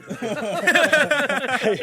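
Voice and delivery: big real laugh; loud laugh. Real, not acted. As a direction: burst out laughing. Host just fell asleep.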